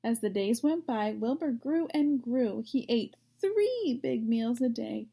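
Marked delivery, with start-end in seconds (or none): none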